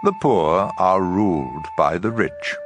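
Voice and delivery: usual speaking voice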